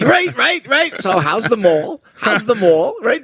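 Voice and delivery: high, hammed-up Jersey guy impression; mock a Jersey mall rat, high; New Jersey accent. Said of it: "I did the Jersey guy in Saturday Night Live".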